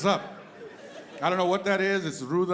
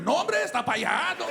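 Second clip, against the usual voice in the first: much higher, exaggerated "No, hombre"; hammed-up cabbie; 11 semitones higher, 3 dB louder than usual. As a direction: overplay the cabbie pointing far off.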